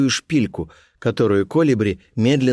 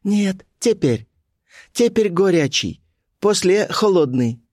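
put-on high, slow, insistent girl; Colibri's voice; 9 semitones higher than usual. A narrator's reading. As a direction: spell it out high and slowly. Colibri insists in simple Russian.

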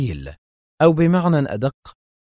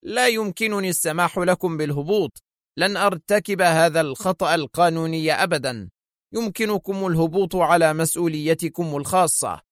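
gruff; 6 semitones higher than usual. A narrator's reading. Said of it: "وهو يقول في خشونة".

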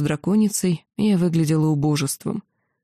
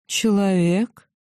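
slow, lilting question; drawn-out melodic query; singsong voice; much slower than usual. A narrator's reading. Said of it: "певучим голосом вопросила".

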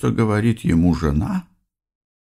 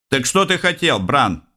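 secretary voice; 7 semitones higher than usual. Acted. Electronic secretary talks to Bran.

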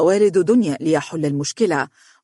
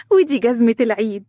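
put-on higher, childlike pride; eager little girl; 6 semitones higher, 3 dB louder than usual. A narrator's reading. Little girl pointing out her Eid shoes, high and eager. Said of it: "وأشارت إلى حذائها بشغف".

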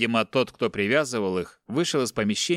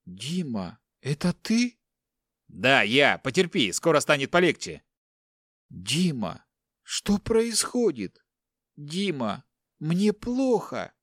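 higher, slow, faltering plea; frightened wife; 3 semitones higher, slower than usual. Acted. Wife pleading weakly to her husband, higher and slow. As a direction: whimper his name, fading.

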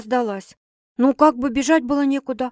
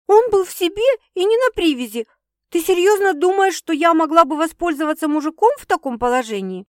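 high, loud, incredulous retort; indignant protest; 8 semitones higher, 5 dB louder than usual. Acted. Girl denying she took advantage, indignant. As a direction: protest as if wrongly accused.

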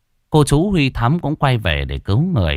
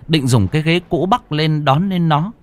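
usual speaking voice

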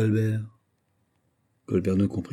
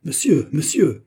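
higher, louder and slow protest; outraged cry; 7 semitones higher, 6 dB louder than usual. Acted. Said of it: "s'écria Colbert". (Colbert s'écrie, outré, plus fort)

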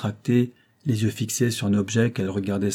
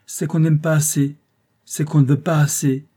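higher and louder, ringing hard; clear, metallic voice; 6 semitones higher, 5 dB louder than usual. A narrator's reading. Rose rebutting André, clear and metallic. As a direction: cut through him with a cold ring. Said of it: "d'une voix claire, métallique".

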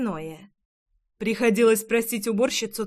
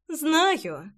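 higher, teasing smirk; lightly amused, pitched up; 5 semitones higher than usual. A narrator's reading. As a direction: say it with a knowing smirk. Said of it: "ухмыльнулась она".

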